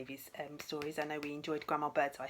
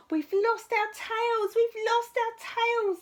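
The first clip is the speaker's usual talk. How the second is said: high, loud, joyful chant of little voices; cheering tadpoles; 13 dB louder, much slower than usual. Acted. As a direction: cheer it out like a crowd of happy tadpoles. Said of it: "they cheered".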